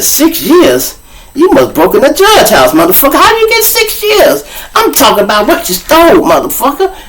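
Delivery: high-pitched disbelief, dragging out the words; incredulous outburst. Retold or imitated. Storyteller replaying his disbelief at the six-year sentence, incredulous. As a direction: react in mock outrage at the sentence. Comic retelling of incredulous reaction.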